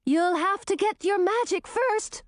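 puffing while running; panting out the retort; out of breath. A narrator's reading. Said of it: "puffed Grisina".

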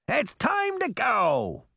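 high, drawn-out call; angry shout. A narrator's reading. Puck storms out of the room, shouting behind him. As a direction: shout it back over your shoulder, high and slow. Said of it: "shouting behind him".

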